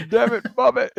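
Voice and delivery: high, loud Texan exasperation; Hank Hill impression. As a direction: shout it like a fed-up Texan dad. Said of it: "that Hank Hill all the time".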